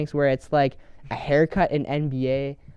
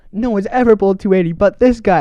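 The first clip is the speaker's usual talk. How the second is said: raised, higher mock announcement; loud retold quote; 6 semitones higher, 9 dB louder than usual. Bowling staff quoting the record, loud mock announcement. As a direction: boom it out like a proud record claim. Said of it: "They're like".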